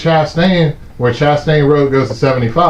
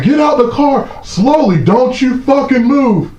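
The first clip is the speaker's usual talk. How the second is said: higher pitched cop voice, slow threat on the last words; imitated cop order; 10 semitones higher than usual. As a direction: play the trooper barking at him. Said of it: "they was like".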